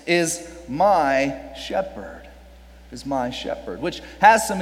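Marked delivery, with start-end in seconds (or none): 0.1-2.3 slow, stress on my; drawn-out emphasis on my. Shown as a demonstration. 2.9-3.8 quieter, lower echo with stress on my; hushed repeat; soft echo. Shown as a demonstration. Softer echo.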